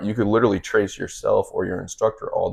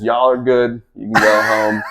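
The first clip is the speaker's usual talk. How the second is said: louder dismissive call; 3 semitones higher, 9 dB louder, slower than usual. Retold or imitated. Coach dismisses other job candidates.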